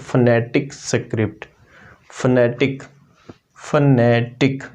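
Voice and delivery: phonetic drawn out slowly, each syllable clear; slow model pronunciation. Shown as a demonstration. Teacher slowly modelling phonetic for the class. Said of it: "Next one is phonetic".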